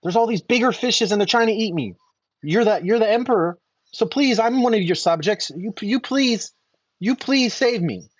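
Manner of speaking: a hint of a tiny frightened fish crying for help; pleading little fish. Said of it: "and the fish says".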